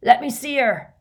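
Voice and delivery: loud, high command; shout. A narrator's reading. Hagrid to the group round Katie, shouting. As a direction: shout to clear everyone away. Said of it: "shouted Hagrid".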